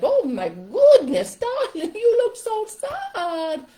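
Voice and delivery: loud, high, drawn-out gushing character; hammed-up, loud sympathetic puppet; different puppet voice. Shown as a demonstration. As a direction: exclaim as a melodramatic puppet, swooping way up. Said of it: "I'd speak in a different voice".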